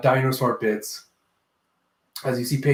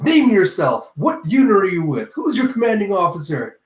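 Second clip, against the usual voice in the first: mock stern demand; 6 semitones higher, 5 dB louder than usual. Ulti interrogating an intruder.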